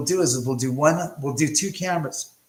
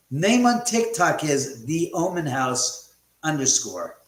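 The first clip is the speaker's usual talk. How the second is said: ordinary talk, nothing marked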